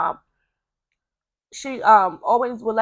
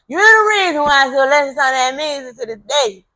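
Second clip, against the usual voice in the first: higher and louder, laughing through the words; 4 semitones higher, 8 dB louder than usual. Real, not acted. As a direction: laugh warmly as you say "You the reason". Thanking brother's mother for music.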